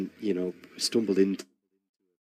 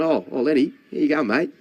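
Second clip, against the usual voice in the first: higher, louder friendly call over the shoulder; cheery mock greeting; 7 semitones higher, 4 dB louder than usual. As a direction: call out a matey hello from behind. Another star greets him.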